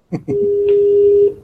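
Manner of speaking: sudden high burst of laughter; loud laugh. Real, not acted. Laugh burst during cold call.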